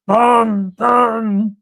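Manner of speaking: loud, punched-out mock catchphrase; big hammed-up impression. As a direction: boom it out like a puppet's catchphrase. Basil Brush catchphrase imitated.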